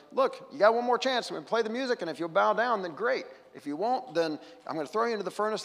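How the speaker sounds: a hint of an angry king giving a last warning; furious threat. Preacher voicing the furious king threatening the three, mildly heated. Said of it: "he's furious and he threatens them".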